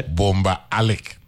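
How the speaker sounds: Alik said slowly as AL-ick, first syllable stressed; correct pronunciation demo. Shown as a demonstration. Correcting the teacher on the name, Alik slowly stressed as AL-ick. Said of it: "pronounce it the right way".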